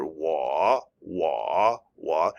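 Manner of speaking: wah with a low dipping third tone, said three times. Shown as a demonstration. Teacher showing students the dipping tone, low dipping wah. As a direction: let wah dip low in the voice. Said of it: "You notice I don't say whoa".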